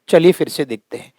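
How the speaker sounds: ordinary talk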